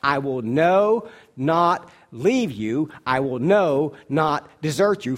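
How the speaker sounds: "I will no, not leave you" very slow, every word weighed and loud; emphatic promise. Shown as a demonstration. Pastor showing the congregation the emphatic Greek, slow and loud. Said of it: "This is the most emphatic way you can say it".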